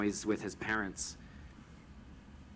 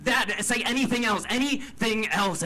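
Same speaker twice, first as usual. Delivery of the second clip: much higher and louder, desperate demand; frantic raised plea; pleading shout; 10 semitones higher, 6 dB louder than usual. Retold or imitated. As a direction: cry out for him to stop, dragging out the last anything. Begging brother to stop apologizing.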